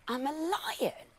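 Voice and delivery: high, slow puzzled question. A narrator's reading. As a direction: ask it like a confused little lion. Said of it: "asked Arnold, puzzled".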